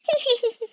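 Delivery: high-pitched giggling cackle; high character laugh; mocking laughter. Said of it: "जोर जोर से हंसने लगती".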